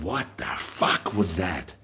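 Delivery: slow, low, hushed demand; hushed retold challenge. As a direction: drop the voice and drag out the question. Retold midnight confrontation.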